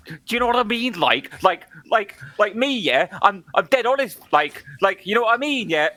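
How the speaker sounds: high-pitched mocking Brummy accent. Mocking a contestant everyone finds horrible, exaggerated Brummy accent. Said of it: "that horrible fucking Brummy accent".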